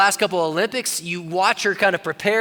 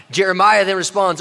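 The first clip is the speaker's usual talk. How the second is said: ordinary talk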